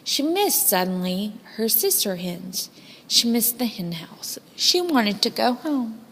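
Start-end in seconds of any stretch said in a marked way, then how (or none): 3.1-6.0 hushed narration full of homesickness; whispered longing. A narrator's reading. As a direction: whisper it like a secret ache.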